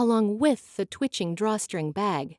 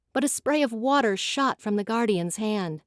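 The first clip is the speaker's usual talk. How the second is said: ordinary talk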